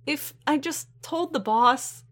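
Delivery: high-pitched, guilty fretting; distressed regret. A narrator's reading. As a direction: fret in a high, upset voice. Upset Nura keeps blaming herself.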